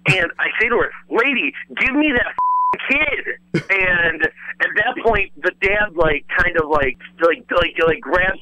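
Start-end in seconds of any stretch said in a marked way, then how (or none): 1.1-3.6 P=A; slow emphatic demand. Retold or imitated. Escalated demand to the mother.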